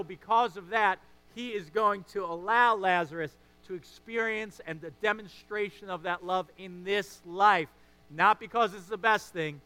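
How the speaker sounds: loud, driving preacher's climax; full-force preaching. Acted. Preacher urging the congregation about Lazarus, loud and driving. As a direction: preach it out to the whole room.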